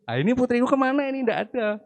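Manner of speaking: a father suddenly missing his daughters, panicking; panicked father. Acted. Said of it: "Dengan rasa yang bingung".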